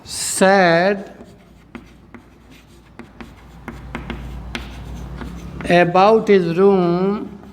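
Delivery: very slow, word by word with long pauses; drawn-out words, long gaps between phrases; dictation. Shown as a demonstration. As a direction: dictate it slowly for students to copy. Teacher dictates answer for writing.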